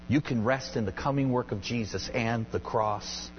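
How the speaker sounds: ordinary talk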